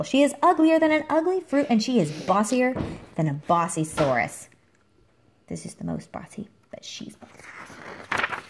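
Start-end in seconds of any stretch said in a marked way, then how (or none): 5.5-8.4 slow whispered secret; whispered aside. A narrator's reading. Reading to a child, whispering a secret about Doris. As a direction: lean in and whisper it.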